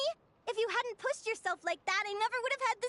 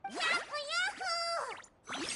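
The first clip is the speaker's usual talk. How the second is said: high, bright yahoo cheer; happy cheer; 11 semitones higher than usual. Acted. Agreeing with Lumi, high happy whoop.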